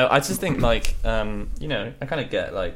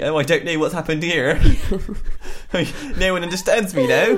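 put-on higher, louder, laughing old voice; bewildered old villager, half laughing; confused centenarian; 6 semitones higher, 5 dB louder than usual. A Japanese hundred-year-old baffled by his village. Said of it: "people in rural Japan turning a hundred going".

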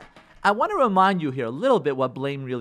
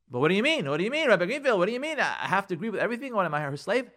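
higher-pitched, hurried protesting husband; indignant protest; 4 semitones higher, faster than usual. Acted. Husband protesting to the rabbi about agreeing, indignant. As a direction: object in rising, baffled indignation.

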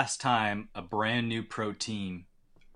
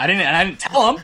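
loud laughing admission; 8 semitones higher, 10 dB louder than usual. Real, not acted. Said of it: "laughing admission".

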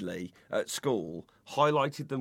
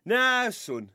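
high, loud self-important mayor voice; blustery pompous mayor; 13 semitones higher, 5 dB louder, slower than usual. Acted. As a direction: proclaim everything like a mayor. Mayor dad in sketch.

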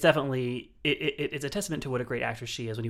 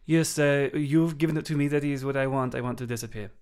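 put-on French accent, falling away at the end; 3 semitones higher, 4 dB louder than usual. Host playing a French actress answering a roundtable question, French accent. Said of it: "go with that French accent every time".

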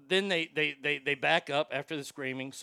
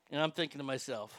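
usual speaking voice

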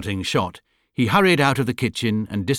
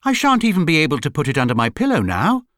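higher, quicker, put-out protest; aggrieved little bear's lament; upset exclamation; 6 semitones higher, 4 dB louder, faster than usual. A narrator's reading. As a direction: complain fast and high, dismayed. Paddington upset at losing tooth.

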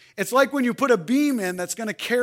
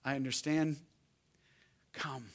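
gentle invitation; 6 semitones lower, 10 dB quieter than usual. Acted. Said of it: "then he says this".

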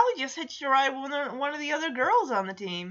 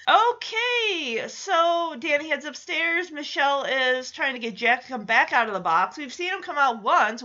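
ordinary talk, nothing marked

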